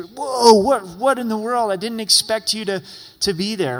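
loud, high startled outburst; acted shock at an unexpected face. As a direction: jolt awake, voice jumping up and loud. Startled waking to kid's face.

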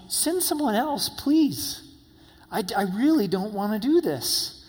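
high, pleading, begging voice; a frightened man begging to get out of it; Moses begging. Retold or imitated. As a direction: plead with God not to be sent. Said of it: "He's begging with God".

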